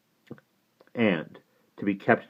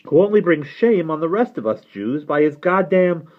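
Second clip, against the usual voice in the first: raised, heated rebuttal; defiant nephew; 11 semitones higher, 8 dB louder than usual. Acted. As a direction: argue back hotly against your uncle. Nephew argues back in quarrel.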